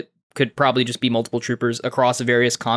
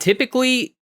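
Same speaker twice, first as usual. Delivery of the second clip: mocking echo; 10 semitones higher than usual. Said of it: "echoes Jaren's word mockingly".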